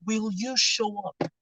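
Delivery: hushed, slow, almost under the breath; quiet question. Real, not acted. Preacher asks the crowd softly, hushed and slow.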